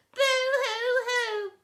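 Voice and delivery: mock sobbing. A narrator's reading. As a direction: wail a comic sob. Family weeping.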